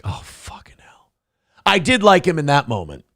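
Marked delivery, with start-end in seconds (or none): 0.0-1.1 muttered under the breath; hushed, defeated aside; whispered curse. Retold or imitated. Comic retelling a TV host's gaffe, whispered curse.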